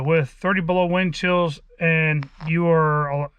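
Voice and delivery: ordinary delivery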